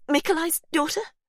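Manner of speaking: high, shocked question; whimpering shock. Acted. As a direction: gasp out in disbelief.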